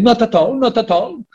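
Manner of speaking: high, singsong British accent impression; imitating a British accent. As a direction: playfully mimic a friend's singsong British accent. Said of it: "he had this accent that was so funny".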